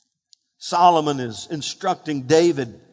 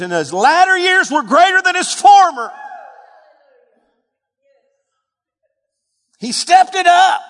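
higher and louder, building to a shout; rising preaching climax; 11 semitones higher, 6 dB louder than usual. Real, not acted.

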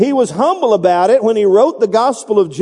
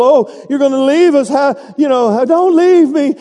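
high, pleading don't leave me; mock fearful whimper; whiny Thomas; 5 semitones higher than usual. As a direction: beg like a scared disciple. Preacher voicing fearful Thomas.

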